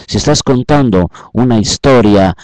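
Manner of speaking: usual speaking voice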